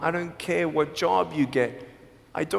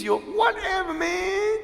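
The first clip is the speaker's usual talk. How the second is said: exasperated; 13 semitones higher than usual. Real, not acted. Listing what he could tease.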